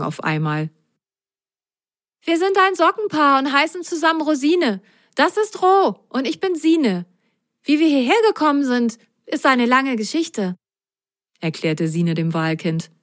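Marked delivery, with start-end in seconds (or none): 2.2-10.6 high, bright-eyed little sock explaining; high storybook child voice; sock girl voice. Acted. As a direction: play Sine, chatty and friendly.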